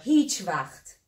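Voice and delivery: ordinary talk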